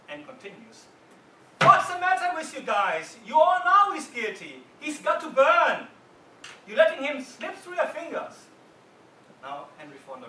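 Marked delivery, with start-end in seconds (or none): 1.6-8.5 high, loud furious yelling; enraged juror screaming at the room; shouting even more. Retold or imitated. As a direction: shout them down, he has to burn. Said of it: "He starts shouting even more".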